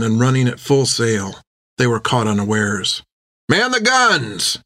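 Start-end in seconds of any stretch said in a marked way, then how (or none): 3.5-4.6 urgent cry. A narrator's reading. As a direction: bark it to the crew. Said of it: "cried Tan".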